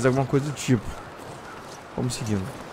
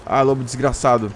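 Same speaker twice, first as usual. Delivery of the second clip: ordinary delivery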